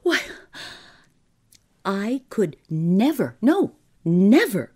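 high, loud, panicky refusal; shocked cry. A narrator's reading. Clerk refusing his employer's murderous demand, shocked cry. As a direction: protest in a rising, agitated voice. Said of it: "cried Mister Crabtree".

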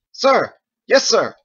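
loud, high military shout; parade-ground reply to the commander; barked at attention. Acted. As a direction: shout it like a drilled soldier. Corporal snaps to attention.

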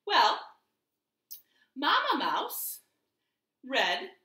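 plain reading voice

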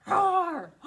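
loud, high playful roar; mock dinosaur roar. Shown as a demonstration. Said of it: "I didn't hear you".

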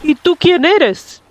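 lightly voiced old whale; a hint of an elder whale. A narrator's reading. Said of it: "le preguntó la ballena más vieja".

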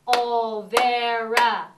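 "Olvera" very slow and loud, three separate beats; clapped syllables. Shown as a demonstration. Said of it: "I'll do it first".

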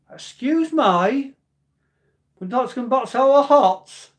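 high, loud, hammed-up Brummie; bad Brummie accent. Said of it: "[name] says in best Brody accent, I can't do a, a Brummie accent".

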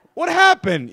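high, loud comic shout; acted-out bewildered yell; calling out. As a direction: holler after fleeing neighbours. Calling out to fleeing neighbours.

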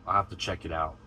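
ordinary talk, nothing marked

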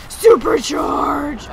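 loud, long-drawn shout; battle cry. Pretend. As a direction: bellow the name of a special attack. Shouting the special move.

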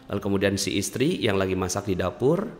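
ordinary talk, nothing marked

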